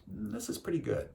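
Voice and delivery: soft, high-pitched musing to yourself; contented murmur. Retold or imitated. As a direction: say it quietly, savouring the moment. Contented remark to himself amid nature.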